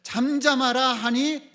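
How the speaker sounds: firm command, voice lifted. Retold or imitated. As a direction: issue it as a command. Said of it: "예수님이 바다도로 명령하셨어요".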